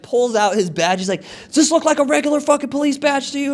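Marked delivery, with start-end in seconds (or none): 1.5-3.5 fast, high-pitched mocking retort; indignant cop. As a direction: act out an officer flashing a badge. Officer challenges him, retold.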